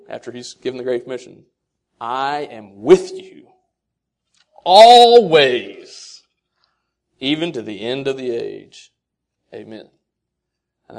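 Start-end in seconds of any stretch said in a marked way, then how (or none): 2.0-8.9 slow build, always ringing out loud; solemn promise. Acted. Preacher quoting Jesus, slow with a loud swell. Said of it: "He says".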